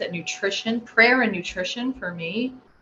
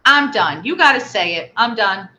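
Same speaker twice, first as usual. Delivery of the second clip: loud, firm ultimatum; firm refusal; 3 semitones higher, 8 dB louder than usual. Retold or imitated. Mother refusing her son a cookie, firm and loud. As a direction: lay down the law to a child.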